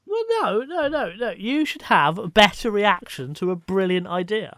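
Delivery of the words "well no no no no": no no no, pitched way up; high-pitched protest. Real, not acted. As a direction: squeak out an indignant protest. Mock protest at a dismissed idea.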